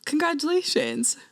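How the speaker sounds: a tender hushed compliment; soft congratulations. Retold or imitated. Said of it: "He goes".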